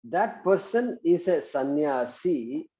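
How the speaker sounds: very slow, spaced-out dictation. Shown as a demonstration. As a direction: dictate slowly for students to write.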